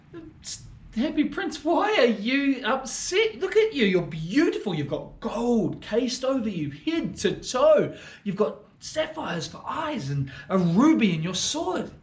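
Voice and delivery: gaze up at the statue in wonder; awed little swallow. Acted.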